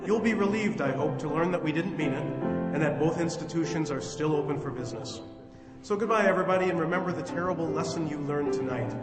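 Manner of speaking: theatrical host's closing address; formal radio sign-off. Acted. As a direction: address the listeners with showman's polish. Host's formal sign-off over music.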